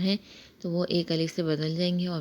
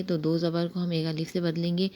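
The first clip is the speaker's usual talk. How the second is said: usual speaking voice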